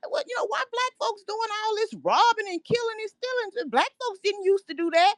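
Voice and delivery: high-pitched retold mom's question; imitating mom. Caller quoting mom's reaction to the TV news, imitating mom. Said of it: "my mom was like".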